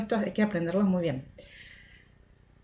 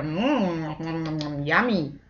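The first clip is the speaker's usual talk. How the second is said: monster munching; 4 semitones lower, 3 dB quieter than usual. Pretend. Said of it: "says the monster".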